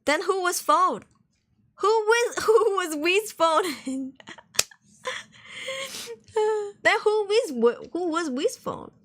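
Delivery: high, slow meme repeat through laughs; a playful hammed-up refrain; giggly mock shock. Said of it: "repeating meme, giggling".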